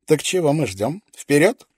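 eager. A narrator's reading. Frankie eager to go.

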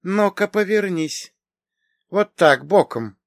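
high-pitched, appraising woman's voice; aunt sizing someone up, pitched high; deliberate instruction. A narrator's reading. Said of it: "медленно произнесла Мария".

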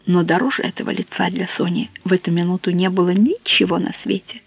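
ordinary delivery, nothing marked

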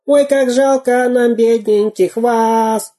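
очень высокий писк, распевно; пропищи, подхватывая песню; писклявый голос. Acted. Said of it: "подхватил писклявым голосом". (разбойник Дырка подхватывает песню писклявым голосом)

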